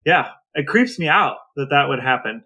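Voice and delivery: normal talking voice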